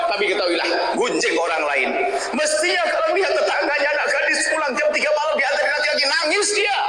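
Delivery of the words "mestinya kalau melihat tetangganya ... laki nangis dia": laughing exhortation. Real, not acted. Preacher rebuking, laughing.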